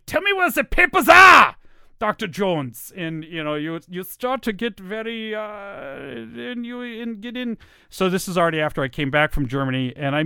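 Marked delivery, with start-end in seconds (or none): 0.0-1.5 high, loud shout in a German accent; German Nazi voice. Shown as a demonstration. Soldier interrogating Doctor Jones for papers, German-accented shout. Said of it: "a German Nazi voice". 2.0-7.6 softer, higher German-accented voice; German villain voice. Shown as a demonstration. Interrogator addressing Doctor Jones, quiet German accent. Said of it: "a German Nazi voice".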